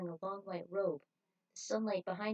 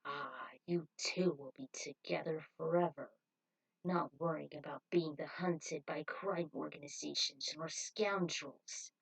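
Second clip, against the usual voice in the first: smooth cult leader; 4 semitones lower than usual. Acted. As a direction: lull them with promises of peace. Cult leader voiced by reader.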